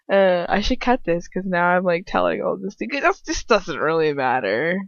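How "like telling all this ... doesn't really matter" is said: ordinary delivery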